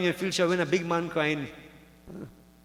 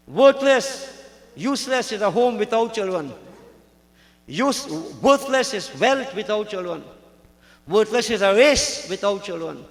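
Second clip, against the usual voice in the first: loud, declamatory lament, pitched up at the start; emphatic lament; 3 semitones higher, 6 dB louder than usual. Acted. Childless Brahman bewails his lot to the Swami, emphatic lament. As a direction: hit each opening word hard.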